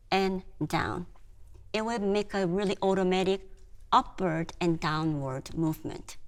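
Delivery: normal talking voice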